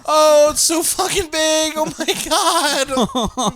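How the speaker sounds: loud, very high-pitched hammed-up wail; mock crying. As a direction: wail it out high, stretching the end. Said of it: "crying on the toilet".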